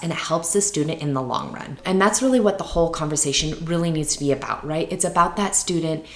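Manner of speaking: ordinary delivery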